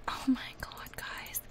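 very soft, breath-only whisper; overcome whisper. Real, not acted. Gamer confiding in viewers about toddler, overcome whisper. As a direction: whisper to them, quite overcome.

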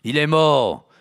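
shout. Acted. Marie cries out.

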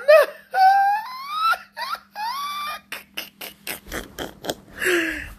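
very high, wheezing long laughing fit; real helpless high laughter; uncontrolled laughter. Real, not acted. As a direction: laugh until you squeal. Cracking up at own impression.

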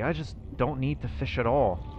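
normal talking voice

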